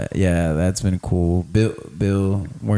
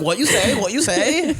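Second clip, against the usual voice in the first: mock accent taunt; 14 semitones higher than usual. Mocking the dude he roasted.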